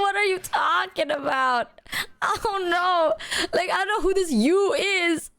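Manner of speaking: high, half-wailing disbelief through laughter; mock-cry it, cracking up; laughing bewilderment. Replaying not knowing who "you" is, laughing bewilderment.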